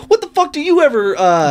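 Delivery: imitating a friend's outraged rant, high and loud; mock outraged yell. Friend rants at his buddy, mock outraged yell. Retelling movie friend's outburst.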